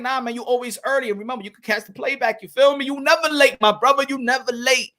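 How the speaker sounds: ordinary delivery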